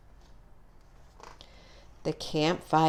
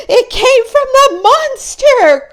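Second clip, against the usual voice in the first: very high, loud shrieking cry; terrified cry; 11 dB louder than usual. Acted. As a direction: shriek in panic about the monster. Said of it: "cried Murphy".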